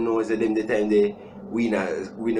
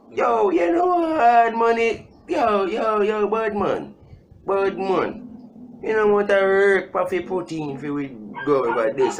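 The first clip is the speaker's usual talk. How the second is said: high, slow mock badman shout; hammed-up rude-boy shout; angry bad man; 10 semitones higher than usual. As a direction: play a hyped-up Jamaican bad man shouting.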